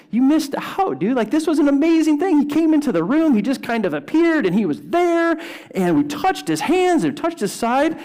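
high, bright-eyed telling of the news; excited disciples. Retold or imitated. Disciples telling Thomas, high and excited. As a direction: disciples gushing to Thomas that he missed it.

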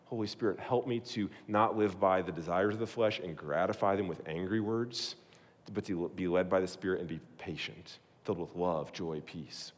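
soft, low, hushed prayer. Shown as a demonstration. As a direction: pray it gently under your breath. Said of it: "prays aloud as example".

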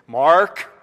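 a hint of an annoyed wife summoning; stern wife calling. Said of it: "the other sound of Tracy's voice".

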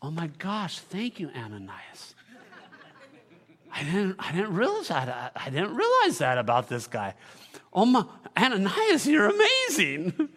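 mock gratitude, starting soft and low, building to gushing amazement; sarcastic. God in heaven thanks Ananias for the news, sarcastic. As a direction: feign astonishment with suppressed laughter. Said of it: "said sarcastically".

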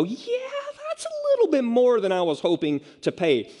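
wriggle out of it in a high voice; mock reluctant balking. Acting out sudden reluctance at cost.

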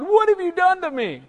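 a high put-on whine; mock complaining. Moses whines to God, high mock complaint. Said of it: "he starts complaining to God".

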